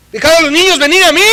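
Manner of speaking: preached scripture; proclaiming Jesus's words. Retold or imitated. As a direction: announce it with authority. Quoting Jesus.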